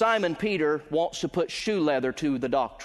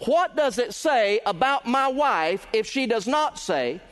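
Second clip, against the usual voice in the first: raised, ringing pulpit voice building in intensity; preaching at full force; 6 semitones higher than usual. Acted. Preacher to his congregation, full-force preaching. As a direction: drive the point home to the congregation.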